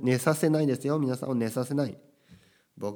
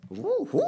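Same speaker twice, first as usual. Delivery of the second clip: a very high, loud "whoa" whoop; overexcited yell; 3 dB louder than usual. Shown as a demonstration. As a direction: whoop like a hyped-up host. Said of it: "元気すぎる感じ".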